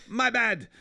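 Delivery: high, loud attempt at an Irish brogue; mock drunk Irishman, loud and high; botched Irish accent. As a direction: call it out in a badly faked Irish accent. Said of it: "whatever the accent was".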